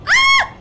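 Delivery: high, loud shriek of alarm; sudden piercing yell; scream. Real, not acted. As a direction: scream like you're caught. Startled in chase.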